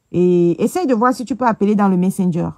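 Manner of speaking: loud first call, then repeated normally. Real, not acted. Repeating request louder to viewer.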